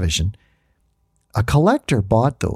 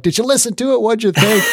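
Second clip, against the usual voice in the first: eager students; 11 semitones higher than usual. Retold or imitated. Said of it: "they're like".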